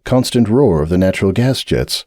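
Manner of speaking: ordinary delivery, nothing marked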